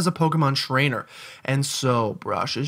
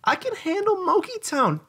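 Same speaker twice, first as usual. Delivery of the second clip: put-on much higher, childish; high kid voice. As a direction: squeak it like a kid.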